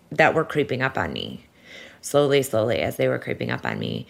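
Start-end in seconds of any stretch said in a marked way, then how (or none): none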